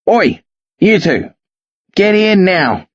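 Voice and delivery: rough shout from a truck; hoarse shout. Acted. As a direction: bark it out hoarsely. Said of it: "a hoarse voice splits the morning".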